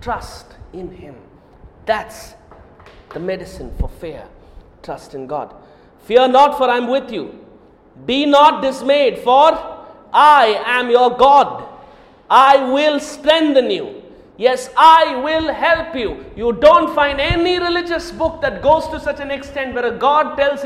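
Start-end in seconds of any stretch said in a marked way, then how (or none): 0.0-5.5 soft hush. Acted. Preacher calming the frightened congregation, softly. Drops soft after the build. 6.0-16.2 loud, slow, every word weighed; proclaiming scripture. Acted. Preacher reading God's promise aloud, slow and booming.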